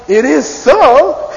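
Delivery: slow, loud, high It is so; emphatic rising affirmation. Shown as a demonstration. Teacher renders the rising tell in English, slow and loud. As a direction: draw it out with full conviction. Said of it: "That means".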